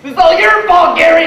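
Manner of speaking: high, loud mock scolding; mom's accusation. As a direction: snap it like a mother blaming her husband. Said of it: "My mom was like".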